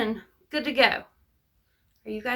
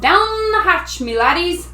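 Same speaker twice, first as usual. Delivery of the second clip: high, loud table-pounding toast; pirate yell; 5 semitones higher, 4 dB louder than usual. A narrator's reading. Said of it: "pounded his fist on the table and yelled".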